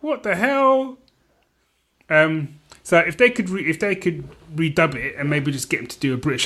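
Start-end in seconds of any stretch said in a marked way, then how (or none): 0.0-1.0 high, disbelieving yelp of a reaction; mock outrage at the bad accent; incredulous. Watching Cumberbatch's American accent, pitched-up incredulous. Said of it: "I was like".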